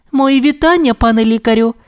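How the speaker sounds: high, polite nurse. Acted. Nurse addresses the doctor, high and polite. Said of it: "мовила вона до Сирника".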